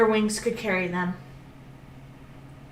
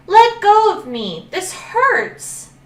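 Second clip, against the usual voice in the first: high, loud cry to be let go; pained plea; 11 semitones higher, 8 dB louder, slower than usual. Acted. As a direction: beg out loud through the pain. Dragon held in crocodile's jaws.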